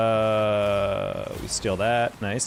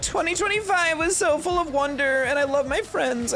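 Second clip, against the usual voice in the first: high sugary mock gushing; mock the sappy posts in a high voice. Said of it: "everyone else was like".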